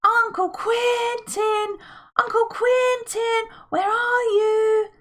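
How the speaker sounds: high, slow, drawn-out calling shout; children shouting for their uncle, loud and slow. A narrator's reading. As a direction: call out across the ruins. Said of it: "So they shouted loudly".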